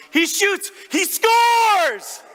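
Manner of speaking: high, full-out hockey goal yell; top-of-lungs scream. Retold or imitated. Dad screams as son scores on breakaway, screaming at full volume. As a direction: scream it like a play-by-play call, stretching the last word. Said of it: "I screamed at the top of my lungs".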